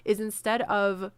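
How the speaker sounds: ordinary delivery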